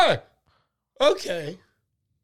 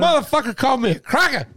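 fast, high, loud indignant rant; indignant outburst; 7 semitones higher, 4 dB louder, much faster than usual. Real, not acted. Comic telling his cohost about the insult, fast and indignant. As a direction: blurt it out, mock-outraged. Outraged retelling.

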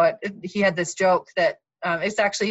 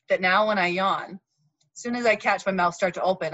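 usual speaking voice

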